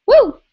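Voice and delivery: loud high whoop; excited woo. Real, not acted. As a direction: let out a big cheer. Cheers after a funny question.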